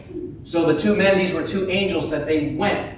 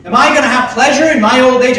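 higher, louder, quick doubting voice; mock incredulous Sarah; 6 semitones higher, 6 dB louder, faster than usual. Preacher acts Sarah doubting the promise, higher and louder. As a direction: play Sarah's disbelief, fast. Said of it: "She laughs".

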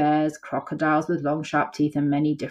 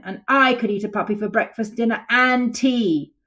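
roaring lion; 6 semitones higher, 3 dB louder than usual. Acted. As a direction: drag out the boast with a roar. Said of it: "roared Lion".

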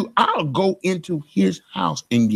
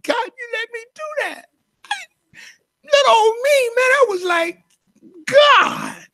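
sobbing out his wonder at God's grace; tearful cry to God. Real, not acted. Pastor recalling his command before God, crying out. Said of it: "I'm crying".

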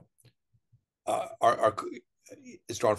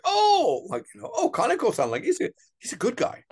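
much higher, louder, gushing put-on voice; mock eager informant; 4 dB louder than usual. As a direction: squeak it up like a nervous fan of the boss. Retells the Yakuza contact's reply.

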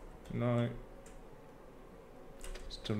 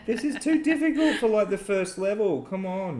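exasperated; 8 semitones higher, 5 dB louder than usual. Real, not acted. Frustrated at hard game.

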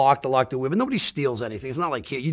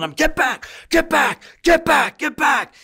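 high, loud repeated shout to back off; retold yelling; 12 semitones higher, 3 dB louder, slower than usual. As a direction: bark the crowd away. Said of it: "he's yelling".

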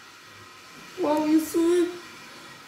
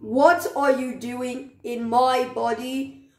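ordinary talk

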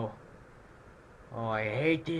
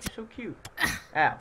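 ordinary talk, nothing marked

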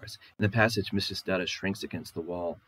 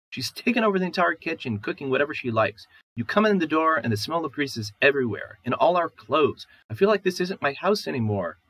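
high, loud, aggrieved outburst; wife protests to her husband about his mother, high and loud; upset wife; 6 semitones higher, 5 dB louder than usual. Acted. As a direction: pour out your grievance against him. Wife lists complaints.

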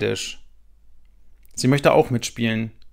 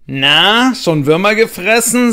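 teasing; 8 semitones higher, 7 dB louder, slower than usual. A narrator's reading. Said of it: "sagt Max".

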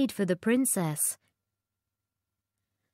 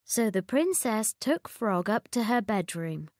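plain reading voice

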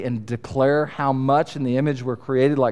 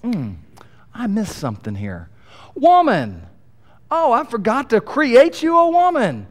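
mock sudden realization; 10 semitones higher, 5 dB louder than usual. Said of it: "you can almost read it like God's gonna go".

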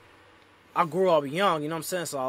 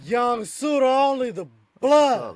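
much higher, slow, loud hype call of his own name; hype shout; 11 semitones higher, 8 dB louder, slower than usual. Real, not acted. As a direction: belt out your name like an MC intro.

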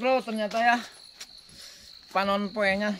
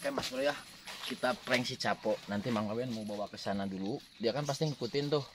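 hushed scheming; 5 semitones lower, 10 dB quieter than usual. Real, not acted. Planning a prank quietly.

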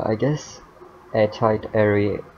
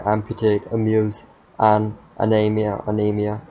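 ordinary talk, nothing marked

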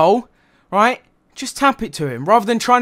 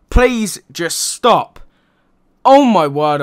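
very slow, louder pleading stop; fed-up plea; 6 dB louder, much slower than usual. Real, not acted. Fan begs Dele Alli to stop shooting, exasperated. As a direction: beg him to stop, exasperated. Fed up with Alli's long shots.

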